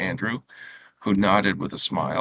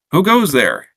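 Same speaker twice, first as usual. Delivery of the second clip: higher, louder challenge; authoritative; 6 semitones higher, 6 dB louder than usual. A narrator's reading. Boy challenging a knock at the gate, authoritative. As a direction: call it out with a boy's authority. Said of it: "in his most authoritative voice".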